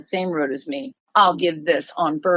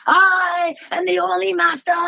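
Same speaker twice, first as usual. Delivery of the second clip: King Kong scream; 12 semitones higher, 3 dB louder than usual. Retold or imitated. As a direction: roar it like a chest-pounding King Kong. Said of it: "he screams".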